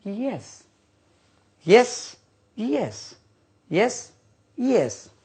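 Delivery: यस said as yes, with a clear y onset, not the letter ई एस; drilling यस as the word yes; pronouncing yes. Shown as a demonstration. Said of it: "ये यस नहीं होता है।".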